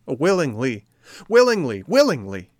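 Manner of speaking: high, loud, joyful repetition; excited puppet, loud and high; eager agreement. A narrator's reading. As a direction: agree with bursting eagerness, voice leaping up. Pinocchio eagerly agrees to obey.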